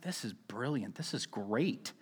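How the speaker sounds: hushed, talking to himself; hushed inner voice. Retold or imitated. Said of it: "and I was like".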